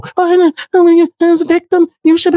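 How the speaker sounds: high, put-on mimicry of opponent; mocking imitation. Streamer mimics opponent defending a victim, mocking imitation. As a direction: parrot them in a high mock voice.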